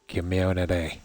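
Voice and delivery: "community" imitated the Northern Irish way, kim-YOW-na-tay. Streamer mimicking the Northern Irish way of saying it. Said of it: "and they would say".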